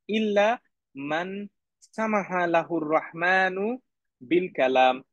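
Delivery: Arabic read very slowly, word by word. Shown as a demonstration. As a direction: draw each Arabic word out deliberately. Slow Arabic translation read.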